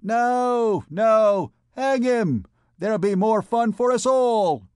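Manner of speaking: high, loud mob shout; jeering crowd answer. A narrator's reading. Vagabond women refusing the condemned man, shouting. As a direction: shout it back gleefully across the crowd. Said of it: "heard them answer".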